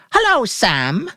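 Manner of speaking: high, slow, forced cheer; bright rehearsal voice. A narrator's reading. Mary rehearsing a greeting to the grocer, brightly forced. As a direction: practise sounding carefree. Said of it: "she said brightly".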